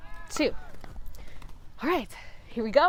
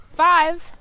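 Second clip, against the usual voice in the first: called-out count; 5 semitones higher, 9 dB louder than usual. Real, not acted. Teacher calling the count.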